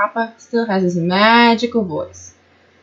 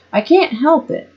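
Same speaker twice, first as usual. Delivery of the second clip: high-pitched, mildly defensive complaint; defensive protest; 7 semitones higher than usual. A narrator's reading. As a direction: protest like a nagged child. Girl protests about her habit.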